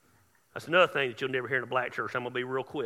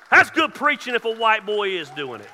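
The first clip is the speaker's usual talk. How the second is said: loud, high exclamation falling at the end; preaching shout; 5 semitones higher, 14 dB louder than usual. Real, not acted. Preacher jokes about his own preaching, in a loud shout. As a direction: belt it out in full preacher voice.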